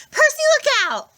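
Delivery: high, loud warning cry. A narrator's reading. Annabeth warns Percy, high and loud. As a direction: shout a warning to a friend. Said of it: "Annabeth cried".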